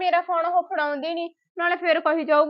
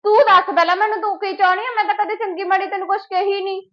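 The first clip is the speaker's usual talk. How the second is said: loud, higher challenge thrown back; angry retort; 4 semitones higher, 5 dB louder than usual. Acted. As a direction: fire the question back at her. Quarrel with sister-in-law. (ਉੱਚੀ ਆਵਾਜ਼ ਵਿੱਚ ਗੁੱਸੇ ਨਾਲ ਜਵਾਬ)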